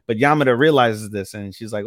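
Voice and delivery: ordinary delivery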